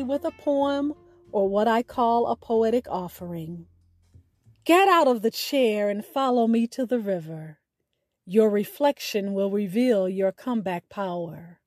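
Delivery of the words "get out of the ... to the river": ordinary delivery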